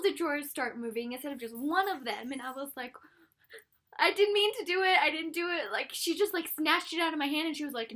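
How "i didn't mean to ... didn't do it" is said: high, loud panicked blurt; mock-panicked I didn't do it; panicked protest. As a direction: plead innocence in a rush. Panicked denial to wand lady.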